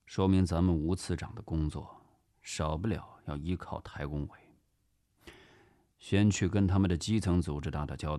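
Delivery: low, quiet, measured boss; understated authority, low and soft; calm superior. Acted. Boss calmly explains plan to aide.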